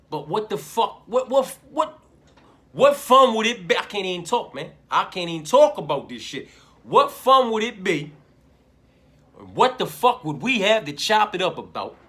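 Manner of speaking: loud and high, repeating what; loud disbelieving rant; worked-up yelling. Real, not acted. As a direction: fire it out, barely able to talk. Worked-up rant about contrarian picks.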